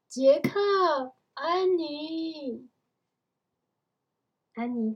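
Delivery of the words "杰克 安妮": raised, drawn-out call of names; distant calling. A narrator's reading. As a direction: call out to children far away. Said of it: "远方传来了叫声".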